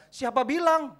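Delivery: high, forceful challenge; shouted rebuttal; loud retort. Acted. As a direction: throw the question back at full volume. Rebutting a parent's objection.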